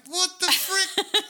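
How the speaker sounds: high, loud, slow shocked cry; shocked outburst. Retold or imitated. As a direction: act shocked at walking in. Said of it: "And I'll be like".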